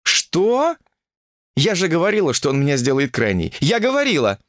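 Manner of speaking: high-pitched indignant protest; indignant outcry. Acted. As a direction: cry out in protest, voice leaping high. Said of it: "как кричит эта женщина". (возмущённый выкрик)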